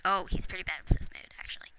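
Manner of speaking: fast unvoiced whisper; whispered aside. Real, not acted. Gamer whispering a quick aside about the Sim's mood.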